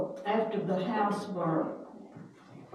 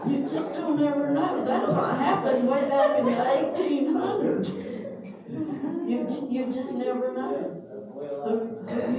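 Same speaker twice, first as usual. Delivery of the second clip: laughing; 6 semitones higher, 5 dB louder than usual. Real, not acted. Laughing at her own joke.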